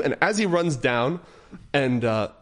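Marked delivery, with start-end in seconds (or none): none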